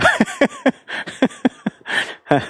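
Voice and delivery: long high-pitched giggle; high laugh. Real, not acted. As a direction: giggle at a student's excuse. Reading student comment, laughs.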